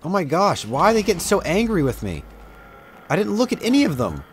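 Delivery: real gamer panic; exasperated outcry. Real, not acted. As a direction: squeal the complaint in disbelief. Mobs all angry at him.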